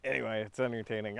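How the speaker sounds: ordinary talk, nothing marked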